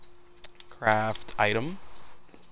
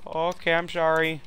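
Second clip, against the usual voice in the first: higher, meek put-on voice; apologetic neighbour; 5 semitones higher than usual. Neighbour apologizing once more, high meek voice. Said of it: "if he's Canadian".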